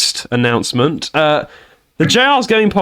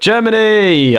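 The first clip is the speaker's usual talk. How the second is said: Germany! drawn out slowly and higher, playfully cheered; mock cheer; 7 semitones higher, slower than usual. Host echoing the guest's cheer, slowly and playfully. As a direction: stretch Germany! out like a mock chant.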